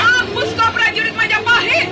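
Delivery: high fierce attack shout; battle shout. Acted. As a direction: shout it mid-strike. Heroine attacks soldier.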